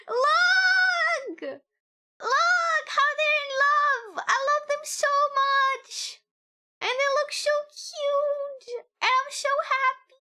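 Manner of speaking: high, loud, slow, near-tearful squealing; ecstatic fangirl scream. Real, not acted. Fan screaming at chat over a couple in love, ecstatic. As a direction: scream it out overcome with joy.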